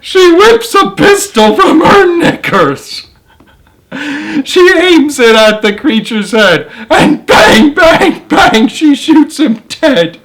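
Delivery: high, cracking voice through giggles; can barely read for laughing; laughing while reading. Real, not acted.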